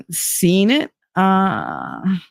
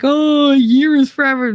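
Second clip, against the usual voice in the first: mock kids; 8 semitones higher, 3 dB louder than usual. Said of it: "they're like".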